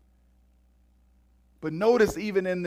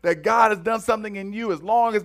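louder and higher, driving preacher cadence; full-force preaching; 5 semitones higher, 5 dB louder than usual. Acted. Preacher urging the congregation to act today, rousing sermon voice. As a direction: build the sermon to a rousing call.

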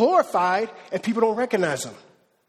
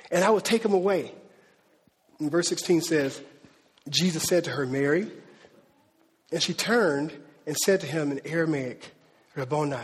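scripture reading; 4 semitones lower than usual. Acted. Preacher reading Jesus's words to Mary aloud, solemn reading.

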